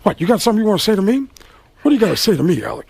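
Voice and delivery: lightly voiced combative star; Al Pacino. Pacino challenging Alec in the hall, combative needling. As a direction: challenge him, quick and needling. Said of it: "And he says".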